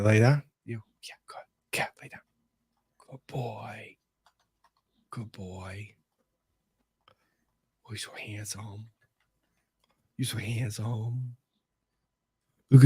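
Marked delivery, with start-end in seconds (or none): 0.6-5.9 soft unvoiced praise; whispering to dog. Real, not acted. Owner whispering praise to settle his dog. 7.9-11.4 tender praise. Real, not acted. Owner quietly doting on his dog.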